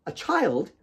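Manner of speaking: high startled question; stunned sister hearing of the baby; shocked. Acted. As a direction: gasp it out in disbelief. Claire shocked at news.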